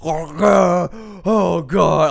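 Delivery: loud mock moans of pleasure; mock moaning. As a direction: ham up her moaning. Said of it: "moaning, yelling all these things".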